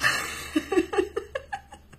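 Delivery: high unvoiced giggle bursting out; burst of laughter. Real, not acted. Reader laughing at herself mid-reading. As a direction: laugh at her own fumble. Giggles at her own slip.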